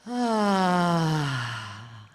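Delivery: big loud audible sigh out; dramatic sigh. Real, not acted. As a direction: let out a big exaggerated sigh. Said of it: "Deep breath in again".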